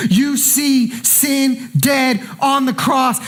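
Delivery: ordinary talk, nothing marked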